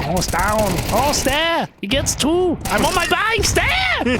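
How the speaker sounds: high-pitched hammed-up caster voice; squeaky excited esports caster impression; Pimp impression. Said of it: "I can do, like, a pimp impression".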